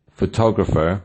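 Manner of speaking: "photographer" with weight on TOG; pho-TOG-ra-pher, "photographer" stressed on TOG; stress on second syllable. Shown as a demonstration. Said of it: "photographer on the second".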